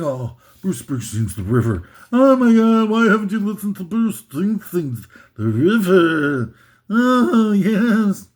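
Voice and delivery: mock-swooning critic voice, gushing and breathy-free. Mocking fawning critics gushing about The River. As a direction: ham up a fawning music critic gushing over an album. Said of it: "these people are saying".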